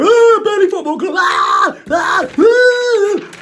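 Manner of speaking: high, loud hammed-up panicked yelling; mock freak-out yell. Freaking out among the snakes, hammed-up high yell. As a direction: run around screaming in mock panic. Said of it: "is it a good idea to go".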